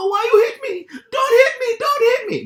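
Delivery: put-on high, loud, wailing plea in an Italian accent; Italian accent, crying. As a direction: beg in a thick Italian accent, sobbing. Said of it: "really thick accent".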